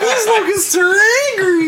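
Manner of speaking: excited yell. Real, not acted. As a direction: shriek it in delight at the lucky roll. Crowing over the successful roll.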